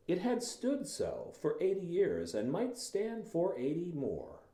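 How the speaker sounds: measured read naming each stop; reading with punctuation. Shown as a demonstration. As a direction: spell out every comma as you read. Rereads passage naming punctuation.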